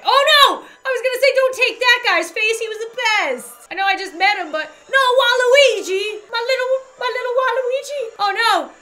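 loud, high-pitched lament; playful distraught wailing. Real, not acted. Player losing little Waluigi, high dramatic dismay. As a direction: wail in overblown dismay.

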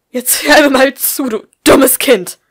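loud, high, slow furious snarl; hissing fury. A narrator's reading. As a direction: spit it at the stupid child. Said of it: "fauchte die Frau". (wütend gefaucht, laut)

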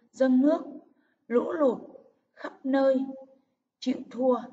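"dâng nước", "lũ lụt", "khắp nơi", "chịu thua" each punched separately; emphatic list from "dâng nước" to "chịu thua", pause after each; stressed key words. Shown as a demonstration. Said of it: "các từ cần nhấn giọng".

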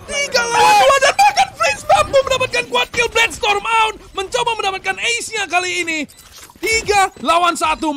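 screaming high and loud; excited shout. Real, not acted. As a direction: roar the multikill call. Caster erupts at multikill.

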